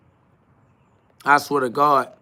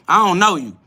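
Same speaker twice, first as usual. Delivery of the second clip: ordinary talk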